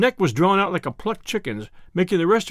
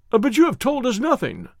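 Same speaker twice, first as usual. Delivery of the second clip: higher, urgent protest; protesting cry; 6 semitones higher than usual. Acted. Doctor protesting to Holmes, mild exclaiming cry. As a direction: press him for answers. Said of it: "cried the doctor".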